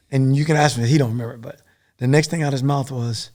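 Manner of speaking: ordinary talk, nothing marked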